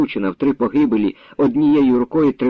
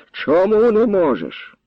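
high, drawn-out indignant demand; angered; 9 semitones higher, slower than usual. A narrator's reading. Grandfather stepping back from the lad, angered. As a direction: stretch the question out in anger. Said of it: "розгнівався".

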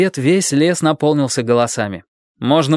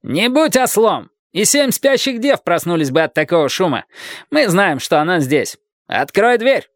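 high, slowed angry commands through a door; knight shouting; 7 semitones higher than usual. A narrator's reading. Sir Daniel yelling at Dick through the locked door, high and commanding. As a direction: scold him like a fool. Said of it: "закричал рыцарь".

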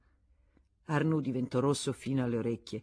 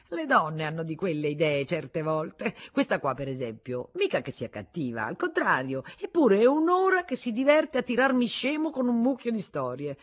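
husband laughing it off, rising high and louder at the end; forced gaiety; 5 semitones higher, 6 dB louder than usual. Acted. Husband mocking his wife's stories to the guest, forced gaiety. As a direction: pretend it's all a joke. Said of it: "ostentando gaiezza".

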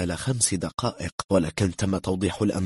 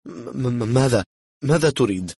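nervous, higher-pitched stutter; stammering; 5 semitones higher, 4 dB louder than usual. A narrator's reading. Frightened German asks what the stranger wants, stammering. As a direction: stumble over the first sound, then blurt it out. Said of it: "قال متمتما".

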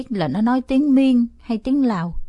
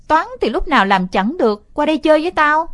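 loud, higher coaxing; coaxing call; 7 semitones higher, 6 dB louder than usual. Acted. Boy pesters his sister across the yard, loud coaxing. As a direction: pester her to come.

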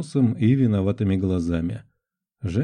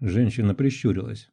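ordinary delivery, nothing marked

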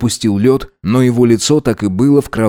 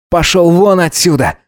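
higher-pitched firm order; angry dismissal; 7 semitones higher than usual. A narrator's reading. Gideon shoves Alex out the door, angry dismissal. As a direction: throw him out with an angry command.

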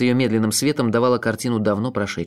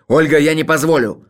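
loud, raised furious shout; beside himself; 4 semitones higher, 6 dB louder than usual. A narrator's reading. Leonid grabs Olga's hand, shouting beside himself. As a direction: explode in frantic protest. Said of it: "Он был вне себя".

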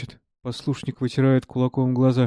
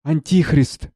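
horrified; 4 semitones higher, slower than usual. Acted. Lipa recognises Savva as Antichrist, horrified. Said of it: "Липа с ужасом".

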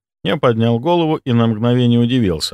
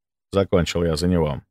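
usual speaking voice

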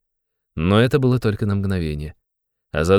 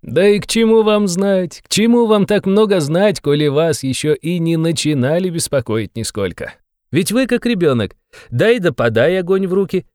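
high, teasing questions ending in a giggle; gleeful, high-pitched needling; sly, merry interrogator; 10 semitones higher, 4 dB louder than usual. A narrator's reading. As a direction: taunt him with playful questions. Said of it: "лукавым и нисколько не встревоженным видом".

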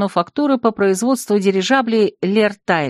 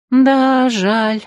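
short agreeing echo, a touch higher; agreeing Marvel; 5 semitones higher than usual. A narrator's reading. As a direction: echo the pity briefly. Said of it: "поддержала разговор Марвел". (согласно, чуть выше)

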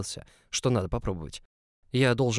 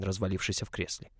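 ordinary delivery

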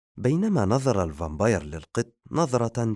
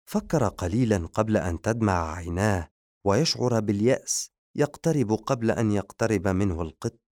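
ordinary delivery, nothing marked

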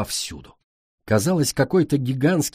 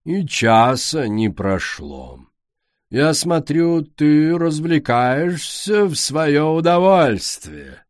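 slow, mocking, higher and louder; sarcastic queen; 5 semitones higher, 5 dB louder, much slower than usual. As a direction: sneer at her amusement, slowly. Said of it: "усмехнулась королева".

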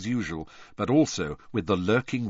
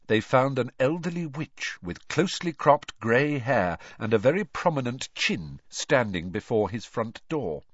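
plain reading voice

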